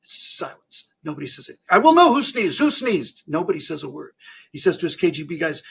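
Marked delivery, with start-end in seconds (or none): 1.7-3.2 loud, high, angry demand; angry Stalin. Acted. Angry Stalin to a silent audience, furious demand. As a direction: thunder it like a dictator interrogating a room. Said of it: "Comrade Stalin is clearly angry".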